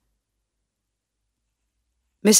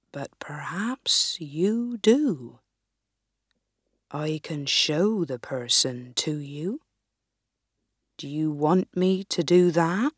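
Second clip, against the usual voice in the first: put-on wise old sage; 2 semitones lower, 5 dB quieter than usual. Wise man offers to reveal.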